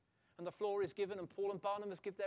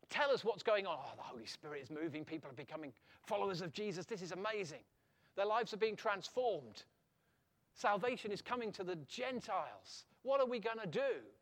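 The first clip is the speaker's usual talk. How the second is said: loud, rising preacher's voice; fervent preaching; 2 semitones higher, 5 dB louder than usual. Acted. Preacher stirring up the congregation, loud and fervent. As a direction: preach it out to the whole church.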